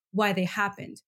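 ordinary talk

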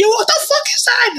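high panicked laughing yelp; nervous laughter. Retold or imitated. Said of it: "I was like".

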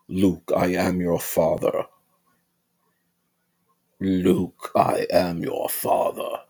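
slow, breathy villain voice; imitating Darth Vader. Vlogger doing the famous villain's line, slow and breathy.

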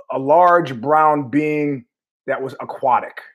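drawn out very slowly; slow comic build. Real, not acted. Comic telling the walrus story, very slowly. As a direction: stretch each word for the joke.

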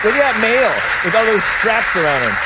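mocking voice. Real, not acted. Mocking the man in the clip.

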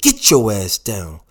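loud, slow scolding mother; stern mother. A narrator's reading. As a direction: order it like a mom to a tantruming kid.

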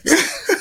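short high giggle after the question; high nervous laugh. Real, not acted. Host asking about biracial kids, laughs nervously.

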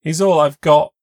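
high, loud desperate cry; tearful plea. A narrator's reading. Kayla pleading that she loves him, loud and tearful. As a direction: plead through tears.

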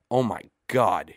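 stunned exclamation, loud and drawn out; cries out. Acted. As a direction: burst out in amazed disbelief. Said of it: "he cries".

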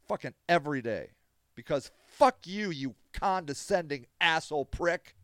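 loud, heated defiance; real anger. Real, not acted. Advocate defends his camp against critics, furious. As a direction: dig in and swear at them. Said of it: "See, you're getting me mad".

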